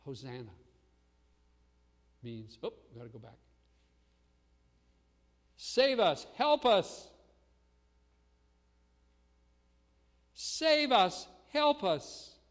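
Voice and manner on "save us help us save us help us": loud, high cry for help; urgent calling out for rescue; desperate plea. Acted. Preacher voicing the crowd's plea.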